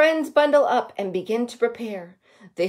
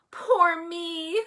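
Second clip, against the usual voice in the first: high, soft forlorn wail; small, high-pitched self-pitying whimper; 8 semitones higher, 5 dB quieter than usual. A narrator's reading. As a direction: wail it softly, lost and scared. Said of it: "he wails".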